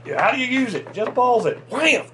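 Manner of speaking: high-pitched hyped-up retelling; high, excited mock exclamation; excited re-enactment. Acts out the excited bait-casting moment.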